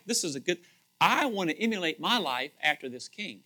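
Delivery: usual speaking voice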